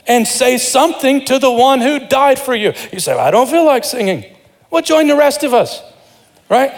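high-pitched, driving sermon climax, pushing the congregation; fiery preaching. Acted. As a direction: urge them to sing, high and full of fire. Preaches at full force.

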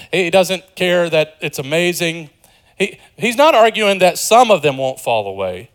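ordinary talk, nothing marked

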